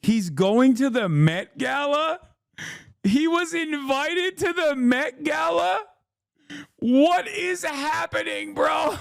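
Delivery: high, slow disbelieving cry; can't believe what you're hearing, voice shooting way up; shocked disbelief. Real, not acted. Stunned by Met Gala news.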